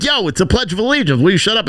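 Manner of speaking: mock rally-goer yelling at reporters; angry heckler. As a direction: scold them to shut up. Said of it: "and they're like".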